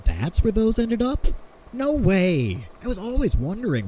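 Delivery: put-on thrilled to hear the old drafts survived; delighted surprise. Inventor learns his old drafts sit in the archives, delighted.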